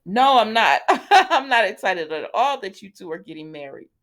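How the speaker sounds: loud, high retort breaking into laughter; laughing mock refusal. Holly refusing to be happy about the engagement, laughing mock protest. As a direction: blurt a hammed-up refusal through laughs.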